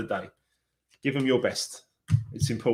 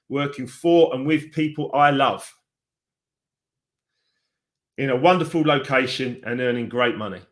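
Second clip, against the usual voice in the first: affirmation reading. Acted. Reads out an affirmation card.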